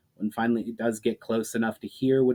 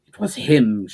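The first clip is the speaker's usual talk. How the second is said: a sharp, louder spat accusation; spat venomously; 9 dB louder than usual. A narrator's reading. Ushara naming her enemy to the voice, spat venomously. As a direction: spit the words out with venom. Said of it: "Ushara spat venomously".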